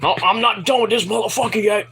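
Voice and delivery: mock defiant quote. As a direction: act out the wrestler refusing to quit. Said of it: "he's like".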